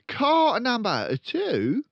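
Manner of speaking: loud, high, very slow dictation; slow dictating father. Acted. Father dictating sums to his son, slow and loud. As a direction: spell out each word for the boy.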